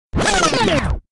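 high, loud shriek falling in pitch; exasperated mock shriek. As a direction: cap the rant with a frustrated squeal. End of the acted yelling tirade.

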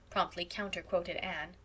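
ordinary delivery

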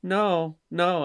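put-on child's frightened no, repeated; imitating scared kid. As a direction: mimic a child's night terror. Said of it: "and he's like".

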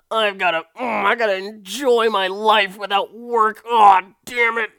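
put-on high, loud, whining old man rant; whiny old husband. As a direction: play a grumpy retiree close to tears, high and loud. Husband's whiny retirement rant voiced.

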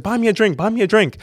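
high-pitched mock pleading; imitated pleading girl. Girl grabbing customer's arm, high-pitched pleading.

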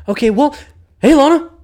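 high, loud forced-casual hello; fake casual greeting. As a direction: cover the nerves with a bright fake hello. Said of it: "a little fake persona here".

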